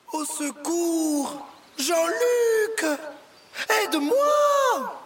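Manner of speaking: high, drawn-out calls for help; cries for help. Acted. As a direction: scream for rescue from afar. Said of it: "réveillé par des cris".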